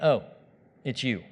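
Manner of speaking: low, soft, unbothered reaction; mildly acted sleepy indifference; unimpressed. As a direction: greet a menace as a minor nuisance. Unbothered man greets the devil in bed.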